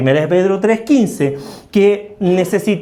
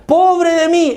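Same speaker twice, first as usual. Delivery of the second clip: high, louder cry of woe over mí; mock lament; 8 semitones higher, 3 dB louder, slower than usual. Preacher paraphrasing Paul to the church, high mock lament. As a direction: wail it like a woeful sigh.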